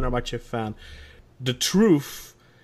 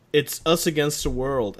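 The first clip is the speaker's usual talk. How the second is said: usual speaking voice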